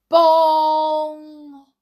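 loud, high ringing bong; clock bell chime. A narrator's reading. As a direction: imitate Big Ben, letting the note ring out. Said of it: "Ben began to chime".

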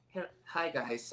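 soft, slow, meek greeting. Acted. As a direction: shrink into a small, timid hello.

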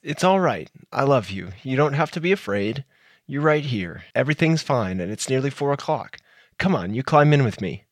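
calm her down gently; tender comfort after a nightmare; soothing husband. Acted.

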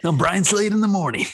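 high, punchy radio DJ intro; shock jock radio host. Retold or imitated. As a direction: announce it like a morning DJ. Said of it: "sounds like a shock jock".

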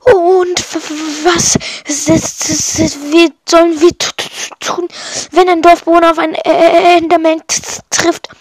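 put-on small shy boy tripping over his words; nervous, halting stammer; timid stammering. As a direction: stutter heavily on the first sounds. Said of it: "Stammelte ein kleiner, schüchterner Junge".

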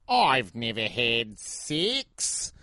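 very slow, exaggerated Aussie accent; Australian accent. Shown as a demonstration. Showing the only line he can do, in an exaggerated Australian accent. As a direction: drag out every vowel in mock Australian. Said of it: "the only thing I can say in an Australian accent is".

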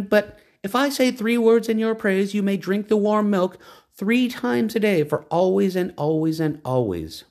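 high, proud woman's voice; lofty woman naming the horse. A narrator's reading. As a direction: announce the horse's new name.

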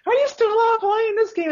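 very high, loud shrieking parent impression; mock yelling mom. Mom bursting into the kid's room, high mock yelling. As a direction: shriek it as a cross mother. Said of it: "started yelling at me".

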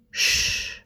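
a soft whispered shh; quiet him gently with a breath; hushing whisper. A narrator's reading. Arthur hushing Merlin as he touches him, whispered.

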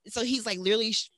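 usual speaking voice

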